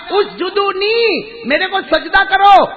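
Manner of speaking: loud, high-pitched command; commanding proclamation. Acted. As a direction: proclaim it over the whole crowd. Acting out divine command.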